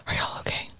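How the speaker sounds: whispering the question; hushed worried whisper. Retold or imitated. Friends listening to sad emo.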